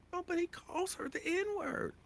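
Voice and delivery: high, soft whiny mimic; whiny mock voice. Mocking Halle Berry's complaint, high soft whine.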